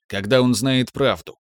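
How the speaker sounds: plain reading voice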